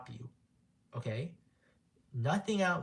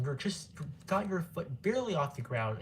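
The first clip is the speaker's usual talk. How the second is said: ordinary talk, nothing marked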